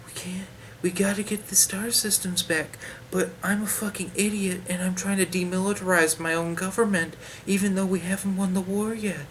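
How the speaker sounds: mock the politician as a whispering fool; mocking Mon Mothma's audiobook voice. Reviewer mocks Mon Mothma's audiobook voice in hushed parody. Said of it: "that's how she sounds in the audiobook".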